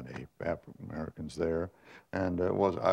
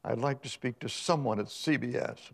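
put-on high-pitched, affected Boston society accent; mock upper-crust Boston caller; phony Boston Park Avenue accent; 6 semitones higher than usual. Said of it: "Boston Park Avenue accent".

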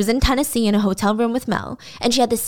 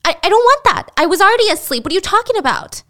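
panicked protest; 8 semitones higher, 4 dB louder, faster than usual. Retold or imitated. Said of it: "So she freaks out on him".

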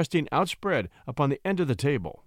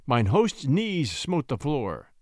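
ordinary delivery

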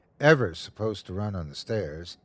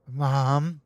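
put-on faint dry rasp of a child; a withered, papery question; like rustling leaves; 3 semitones higher than usual. Withered son calls to his mother, dry and rustling. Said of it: "his voice like rustling leaves".

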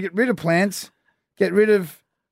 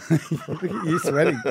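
laughing; 5 dB quieter than usual. Real, not acted. Teasing a nervous co-host.

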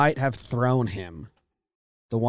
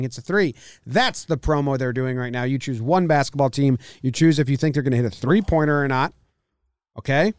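ad read; 3 semitones higher, 3 dB louder than usual. Acted. Sponsor ad read.

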